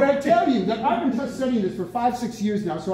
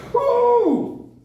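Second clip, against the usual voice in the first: excited woo; 11 semitones higher, 5 dB louder than usual. Real, not acted. Preacher cheers his own point.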